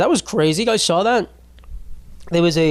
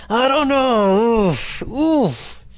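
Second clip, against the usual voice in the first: laughing groan; 7 semitones higher, much slower than usual. Pretend. Fretting over girls aging badly.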